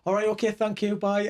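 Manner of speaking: polite posh sign-off on phone. Retold or imitated. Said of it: "like, posh voice".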